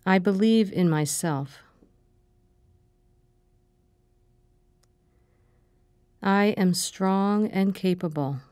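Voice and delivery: calm, measured meditation voice, short phrases with long pauses; guided meditation. Acted. Meditation leader giving affirmations to repeat after her, calm and measured. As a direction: guide them gently through breathing and affirmations, unhurried.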